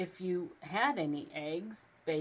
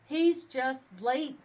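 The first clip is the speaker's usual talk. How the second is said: slow, higher reassurance; reassuring; 6 semitones higher, 6 dB louder, slower than usual. A narrator's reading. Father reassuring his daughter, slow and higher. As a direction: reassure the child gently. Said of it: "told his daughter".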